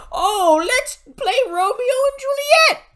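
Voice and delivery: very high, loud mocking squeal; mock enthusiasm. Reviewer parodies "let's play Romeo and Juliet", mock enthusiasm. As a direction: squeal the suggestion in a put-on high voice. Mock-quoting the dreaded suggestion.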